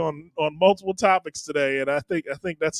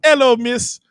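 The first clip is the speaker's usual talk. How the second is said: higher-pitched put-on Eastern Bloc accent; 5 semitones higher than usual. Man imitating Eastern Bloc films for his co-hosts, Eastern Bloc accent. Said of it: "it's the same accent you use for everything".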